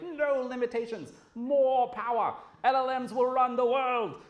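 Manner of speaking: high, loud, slow booming proclamation; hammed-up hype man declaring AI's power. Mocking AI hype people.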